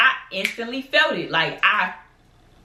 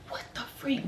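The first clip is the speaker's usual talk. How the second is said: breathy shocked whisper; whispered panic; 5 semitones higher, 14 dB quieter than usual. Retold or imitated. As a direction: hiss it under breath, stunned. Panic at missing shoes.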